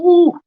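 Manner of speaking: high, loud gleeful ooh; a loud, high told-you-so whoop. Real, not acted. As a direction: squeal it out in triumph. Gloating over being proven right.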